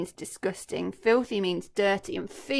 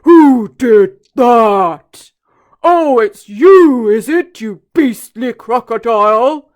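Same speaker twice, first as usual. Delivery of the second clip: much louder, very slow booming elephant; big deep voice; 2 semitones higher, 21 dB louder, much slower than usual. Acted. Trunky confronting the crocodile, big deep voice. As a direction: boom it like a huge elephant. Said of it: "in his big, deep voice".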